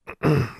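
short throat clear before speaking. A narrator's reading. Alexandra clears her throat before addressing the elves, brief throat clearing. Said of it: "She cleared her throat".

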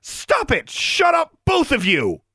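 high-pitched, exasperated shout; shouted order. A narrator's reading. Alexandra breaks up the quarrel, shouting. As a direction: shout them both down.

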